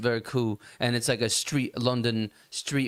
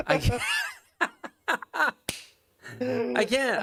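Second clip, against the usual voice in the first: high-pitched helpless laughter mid-sentence; cracking up; 10 semitones higher than usual. Real, not acted. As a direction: laugh so hard you can't get the words out. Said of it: "I can't do it, dude".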